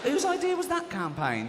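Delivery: high-pitched comic disbelief; incredulous outrage. Acted. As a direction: cry out in mock outrage. Acted outrage at the campaign.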